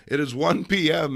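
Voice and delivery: usual speaking voice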